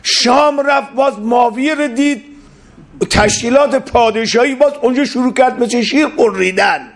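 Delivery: high, intense sermon narration; fiery preaching. Acted. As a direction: tell it as a rising pulpit story.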